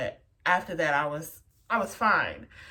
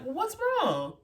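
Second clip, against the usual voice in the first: sister asking; 11 semitones higher than usual. Retold or imitated. Sister meets her, concerned.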